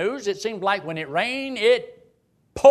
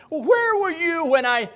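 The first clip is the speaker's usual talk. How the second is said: booming God; 12 semitones higher, 5 dB louder than usual. Pretend. God confronting Job.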